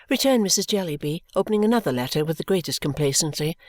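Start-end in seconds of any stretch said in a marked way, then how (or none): none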